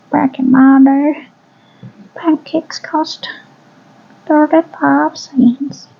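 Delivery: put-on high, slow little old lady voice; elderly singsong. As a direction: sing it out like a sweet old granny. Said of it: "in the little old lady voice".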